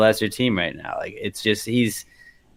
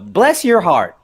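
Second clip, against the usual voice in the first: mock-sweet Southern put-down; 6 semitones higher, 5 dB louder, slower than usual. Quotes Southern put-down in exaggerated sweetness.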